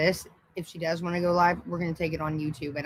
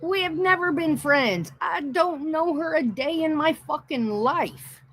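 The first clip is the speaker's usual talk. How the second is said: emphatic high protest; 9 semitones higher than usual. Real, not acted. Insisting they were never friends.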